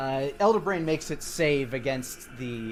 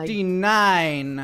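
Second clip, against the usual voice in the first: loud, high, stretched-out number; excited announcement; 5 semitones higher, 9 dB louder, much slower than usual. Real, not acted. Announcing a huge damage roll, excited. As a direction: belt out the big roll slowly.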